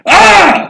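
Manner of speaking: high, loud mock scream; short scream of a startled driver, imitated. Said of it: "And he just scream".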